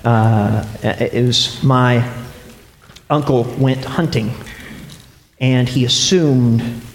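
low, halting, grave telling; sombre. Real, not acted. Preacher telling the congregation of his uncle's fatal hunting mistake, sombre. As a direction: drop low and let the pauses weigh. Grave true family tragedy after jokes.

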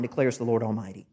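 ordinary talk

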